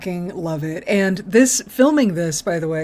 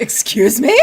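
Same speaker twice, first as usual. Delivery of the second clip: offended Riker; 3 semitones higher, 3 dB louder than usual. Retold or imitated. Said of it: "He was so offended".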